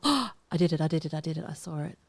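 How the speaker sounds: loud, gleeful I did it; cheering a tricky placement; excited. Real, not acted. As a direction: burst out with triumph. Triumph after sneaking piece in.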